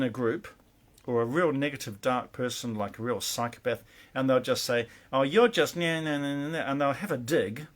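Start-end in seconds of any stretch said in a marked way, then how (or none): 5.1-6.6 mock nagging imitation, pitched up; mocking dig. A negative group member having a dig, mock jeering. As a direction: imitate a sniping critic's jeering nah-nahs.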